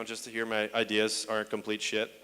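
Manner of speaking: ordinary delivery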